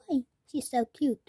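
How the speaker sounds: ordinary talk, nothing marked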